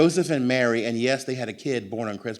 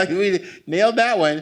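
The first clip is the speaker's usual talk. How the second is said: laughing, higher and louder; giggling triumph; 8 semitones higher, 9 dB louder than usual. Retold or imitated. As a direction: giggle it like kids who got away with it. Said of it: "start giggling".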